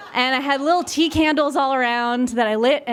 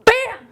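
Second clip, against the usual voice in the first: loud, high imitated bang; explosive spoken sound effect; sudden bang; 11 semitones higher, 5 dB louder than usual. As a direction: burst out with a startling crash noise. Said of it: "suddenly we hear".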